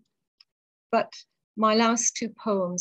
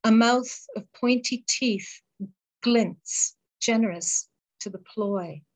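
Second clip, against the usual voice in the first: poem recital. Acted. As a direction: hold the lines gently and let the pauses breathe. Reads her deathbed poem.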